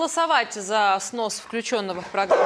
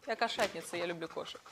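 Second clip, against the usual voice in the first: quiet; 7 semitones lower, 13 dB quieter, faster than usual. Real, not acted. Presenter aside, trailing.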